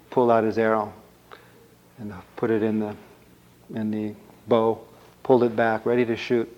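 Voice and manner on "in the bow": plain reading voice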